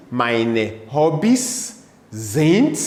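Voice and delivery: Meine Hobbys sind stretched slowly with a rise on sind; very slow model phrase. Shown as a demonstration. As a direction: lay out the sentence frame slowly. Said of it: "the answer is".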